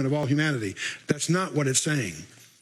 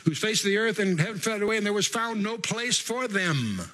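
higher recital slowing to the close; scripture reading; 6 semitones higher than usual. Acted. Preacher resumes Revelation after an aside, scripture reading. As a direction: declaim it, drawing out the last phrase.

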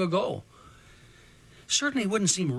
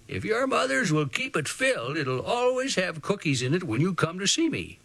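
put-on kind old man; 3 semitones higher than usual. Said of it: "his kind old gray eyes had twinkled as he said".

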